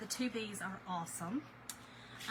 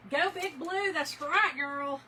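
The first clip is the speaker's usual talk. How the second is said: higher, louder fan cheer; rally for the team; cheering on Big Blue; 5 semitones higher, 6 dB louder, slower than usual. Real, not acted. Cheering her home team.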